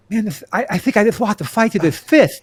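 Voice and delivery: high, loud lisping Tyson voice; Mike Tyson impression. Host imagines the boxer losing to a fish, lisping Mike Tyson impression. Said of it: "Mike Tyson would probably like".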